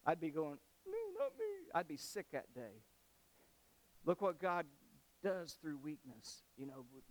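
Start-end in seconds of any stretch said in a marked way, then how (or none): 0.8-1.5 tiny high-pitched whine; mock crying. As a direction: squeal it like a tearful schoolkid, hammed-up. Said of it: "without crying".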